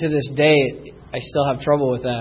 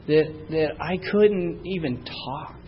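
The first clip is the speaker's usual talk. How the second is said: normal talking voice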